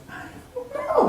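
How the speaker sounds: very high, soft, weepy whimper; tearful kid. Retold or imitated. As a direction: answer like a crying, lost child. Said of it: "'cause I was crying".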